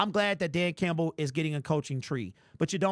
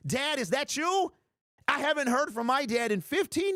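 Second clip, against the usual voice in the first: mock hopeful; 8 semitones higher than usual. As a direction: ham up a long-lost son. Jokes chatter is his dad.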